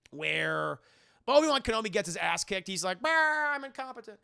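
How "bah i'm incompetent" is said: high-pitched hammed-up moan; mock wail. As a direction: wail it high, mocking a hopeless loser. Mocks defeated Obi-Wan.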